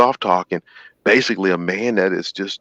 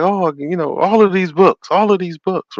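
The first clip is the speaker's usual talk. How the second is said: high, loud delight over books; thrilled; 10 semitones higher, 4 dB louder than usual. Retold or imitated. Lonely man finding library books, overjoyed high voice. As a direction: squeal over the pile of books. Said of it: "oh, he's like".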